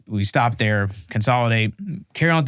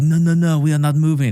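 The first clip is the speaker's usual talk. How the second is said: put-on higher-pitched French accent, insistent; mock French accent, pitched up; French reporter; 5 semitones higher, 3 dB louder than usual. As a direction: imitate a stubborn French reporter refusing. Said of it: "I got these French reporters".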